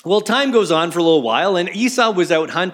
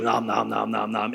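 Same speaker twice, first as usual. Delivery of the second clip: soft, lower nom-nom munching; munch it like a starving man, softer; mock eating noises; 6 semitones lower, 6 dB quieter than usual. Esau wolfing down the stew, soft mock munching.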